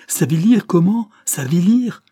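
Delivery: high, loud incredulous echo; shocked disbelief. Acted. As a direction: throw the word back, stunned. Narrator's shocked repetition. (stupeur incrédule, voix haute et forte)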